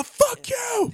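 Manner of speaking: high-pitched mock heckler's shout; high, jeering yell; yelling crowd. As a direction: imitate a restless crowd yelling. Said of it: "they're all yelling".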